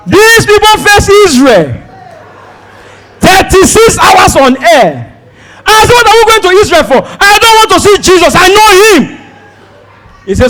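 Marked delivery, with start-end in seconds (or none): 0.1-9.0 high, loud exasperated rant; fed up with yet another stop, ranting loud; exasperated outburst. Retold or imitated. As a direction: complain loudly in disbelief. Frustrated retelling, more stops.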